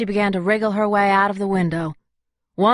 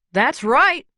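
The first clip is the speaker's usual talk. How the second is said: put-on higher, young man's voice; younger male voice; 7 semitones higher, 3 dB louder than usual. A narrator's reading. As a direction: sound like an eager young guy. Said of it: "a much younger male voice".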